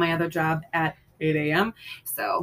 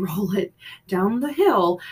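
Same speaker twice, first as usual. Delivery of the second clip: usual speaking voice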